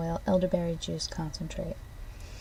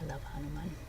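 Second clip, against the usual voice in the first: barely audible; 3 semitones lower, 12 dB quieter, faster than usual. Real, not acted. Fond aside, very soft.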